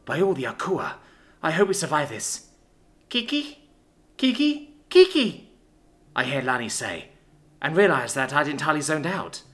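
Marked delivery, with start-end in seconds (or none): 3.1-5.5 put-on high little-girl voice, growing louder; child calling out. Lani trying to get her zoned-out sister's attention, insistent calling. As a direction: call insistently, louder each time.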